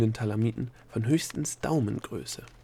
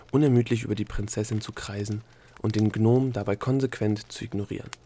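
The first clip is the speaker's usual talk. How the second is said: ordinary delivery, nothing marked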